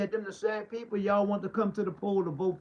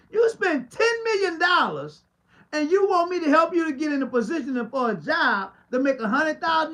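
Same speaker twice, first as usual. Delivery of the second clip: higher and louder, incredulous; heated preaching; 8 semitones higher, 8 dB louder than usual. Acted. Pastor calling out politicians who want votes, higher and louder. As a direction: let the outrage climb.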